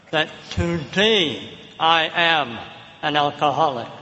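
"am" slowed and weighted, then dropping low; stress on am. Retold or imitated. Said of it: "I say it that way".

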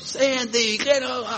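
high called-out greeting; mock yell from across the road; senator yelling across street. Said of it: "and he yells".

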